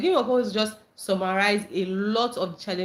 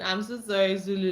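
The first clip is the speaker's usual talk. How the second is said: usual speaking voice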